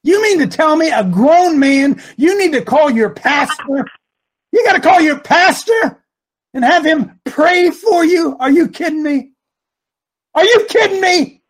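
incredulous rebuke. Real, not acted. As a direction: berate them in loud disbelief. Impassioned rebuke of grown believers.

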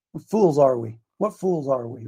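usual speaking voice

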